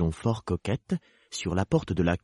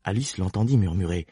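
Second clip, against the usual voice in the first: plain reading voice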